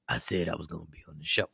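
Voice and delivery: low, soft, slurred sleepy mumble; groggy drugged voice. Retold or imitated. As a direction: sound doped up on painkillers. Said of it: "Now I'm saying the voice on the text".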